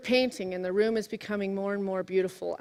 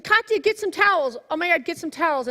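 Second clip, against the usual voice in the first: higher-pitched, urgent snap at daughter; stressed, bitey; 7 semitones higher, 5 dB louder than usual. Retold or imitated. Mother to daughter over spilled paint, stressed and biting. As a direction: re-enact a stressed parent's biting order. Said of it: "I stressfully said".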